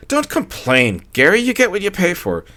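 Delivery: high, breathy-free gushing lady voice; wife cooing to her husband. A narrator's reading. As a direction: coo it like a tipsy wife. Gladys voiced in story.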